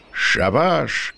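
a contained narrated shout; calling out. A narrator's reading. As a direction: call a halt to the crew. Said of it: "крикнув Летючий".

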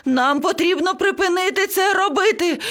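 very high frantic parrot voice; panicked screeching. A narrator's reading. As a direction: shriek it out in a panic. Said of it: "зарепетував папуга". (панічний високий вереск)

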